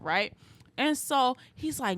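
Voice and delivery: ordinary talk, nothing marked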